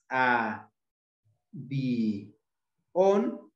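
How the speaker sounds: "a, bi, on" with a clear pause after each syllable; three separate syllables. Shown as a demonstration. Teacher showing a broken diphthong, "a, bi, on" syllable by syllable. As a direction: split "a, bi, on" into detached beats, i apart from o. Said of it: "se separa el diptongo y se forman dos sílabas".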